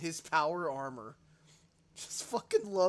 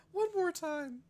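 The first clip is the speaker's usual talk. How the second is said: much higher, still giddy; 11 semitones higher than usual. Real, not acted. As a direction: repeat the plea high and bright. Repeats request.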